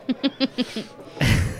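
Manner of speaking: high-pitched giggle; laughing. Real, not acted. Game master amused by own Irish act, laughing. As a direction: crack up at your own act.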